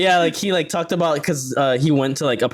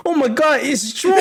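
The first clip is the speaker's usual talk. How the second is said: mock British soldiers; 11 semitones higher than usual. Friend acting out British soldiers spotting George, high mock panic. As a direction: squeal it like panicked redcoats. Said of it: "the British people are like".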